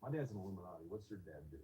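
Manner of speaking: mildly condescending, retold under the voice. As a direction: quote him with quiet, dropped-off superiority. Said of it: "he said it really condescending".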